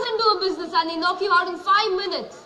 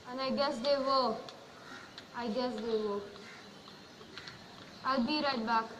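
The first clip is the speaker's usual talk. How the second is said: fading, lower and softer; resigned; 5 semitones lower, 14 dB quieter than usual. Acted. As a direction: let it drain away. Father accepts his guilt.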